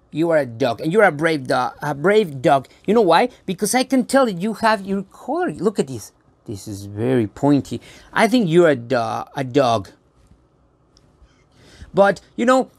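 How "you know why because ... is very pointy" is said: ordinary delivery, nothing marked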